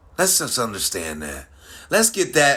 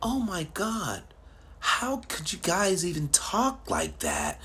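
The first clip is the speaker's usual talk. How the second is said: higher, slow, mock-shocked woman's voice; mocking the young lady; 8 semitones higher, 6 dB quieter than usual. Quoting the offended young lady's comment, mock-shocked. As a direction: lightly mimic her prissy outrage. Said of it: "She said".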